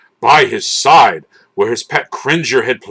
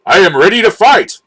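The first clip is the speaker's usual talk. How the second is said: brave shout; 6 semitones higher, faster than usual. A narrator's reading. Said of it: "shouted the brave prince".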